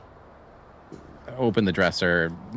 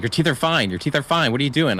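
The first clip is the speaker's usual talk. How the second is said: raised, insistent reassuring; urgent reassurance; 8 semitones higher, 5 dB louder than usual. Acted. Player's character urging the frantic doctor, raised and insistent. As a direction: talk him down fast and firm. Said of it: "so I'm gonna be like".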